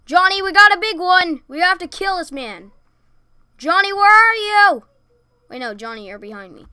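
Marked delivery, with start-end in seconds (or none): 0.1-4.8 loud, high eager calls; excited calling. Pretend. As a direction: call out with urgency.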